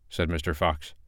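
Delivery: plain reading voice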